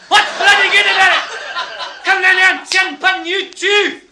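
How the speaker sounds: high, screamed Scottish threats; wild Scotsman. Retold or imitated. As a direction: yell back in a raging Scottish voice. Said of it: "this Scotsman goes wild".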